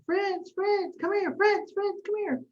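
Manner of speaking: high calling out down a dark street; hollering for the dog. Retold or imitated. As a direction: call the lost dog's name, pitched high. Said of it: "I'm hollering".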